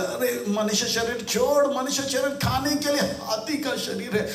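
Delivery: high acted rebuke climbing to a shout; scold, then order them down; hammed-up indignation rising in pitch; Nature scolding.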